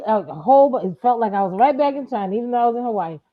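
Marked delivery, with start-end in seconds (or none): none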